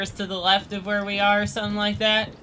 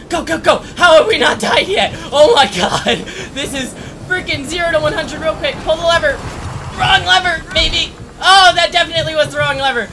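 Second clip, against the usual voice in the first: frantic; 8 semitones higher, 6 dB louder than usual. Real, not acted. Escape run with monster.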